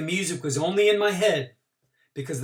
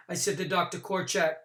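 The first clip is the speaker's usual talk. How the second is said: ordinary delivery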